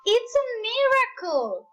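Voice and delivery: high, loud, amazed cheering; amazed shout. Acted. Animals cheer Gerald's dancing, high loud amazed shout. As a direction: cheer him on in wonder. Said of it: "They shouted".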